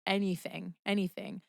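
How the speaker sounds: low, hushed man's reply; earnest promise. Retold or imitated. Man answering her plea to ask something, hushed earnest imitation. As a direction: softly imitate his devotion.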